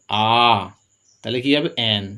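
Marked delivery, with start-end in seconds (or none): none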